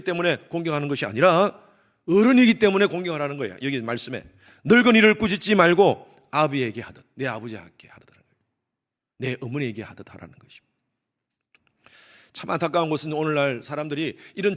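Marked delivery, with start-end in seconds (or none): 4.6-7.1 emphatic quote. Real, not acted. 7.1-10.7 hushed explanation. Real, not acted. 구절 뒤 속삭이듯 풀이.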